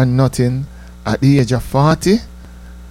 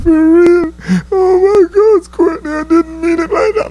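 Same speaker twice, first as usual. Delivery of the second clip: high, loud fake sobbing plea; mock crying plea; 4 dB louder than usual. Girlfriend begging Courtney to forgive her, loud, high, hammed-up crying. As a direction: wail her apology in a high, hammed-up cry. Girlfriend quoted pleading in tears.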